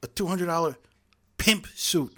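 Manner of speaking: awkward retort. Retold or imitated. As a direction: fumble the insult like a bad actor. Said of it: "And very awkwardly, he goes".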